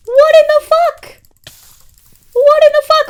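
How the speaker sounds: high, loud disbelieving shriek; outraged shriek. Real, not acted. Streamer shrieking at chat over the fire. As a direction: scream it in disbelief.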